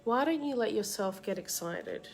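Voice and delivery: usual speaking voice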